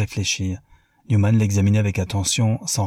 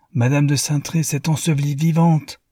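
higher, pained outcry; exclaimed; 7 semitones higher, 3 dB louder than usual. A narrator's reading. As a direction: cry it out in disbelief. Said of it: "s'écria Newman".

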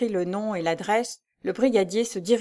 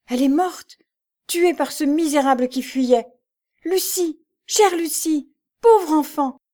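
high, loud, anguished wail; sobbing grief; 8 semitones higher, 6 dB louder than usual. A narrator's reading. Jeanne over Lucie's body, high anguished wail. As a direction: cry out over the body as sobs choke you. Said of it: "qu'étouffaient les sanglots".

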